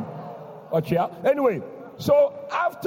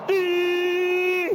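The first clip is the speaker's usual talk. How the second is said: high wailing sob, imitating the brother; mock the brother's sudden cry; imitated wail; 7 semitones higher than usual. Pastor imitates the crying brother's high wail. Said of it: "he started crying".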